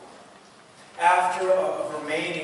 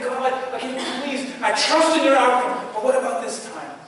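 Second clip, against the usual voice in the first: loud, higher, insistent plea; a hint of earnest intercession; pleading with God; 8 semitones higher, 10 dB louder than usual. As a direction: beg once more, trusting yet pushing.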